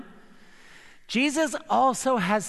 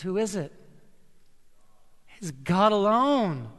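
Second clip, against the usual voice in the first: usual speaking voice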